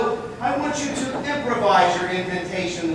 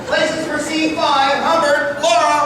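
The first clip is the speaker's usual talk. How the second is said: calling out; 5 semitones higher, 6 dB louder than usual. Real, not acted. Director calling cast to places.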